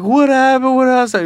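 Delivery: high, loud frantic mother; panicked mom. Retold or imitated. Mother at the door, frantically asking her son. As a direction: mimic a frightened mother.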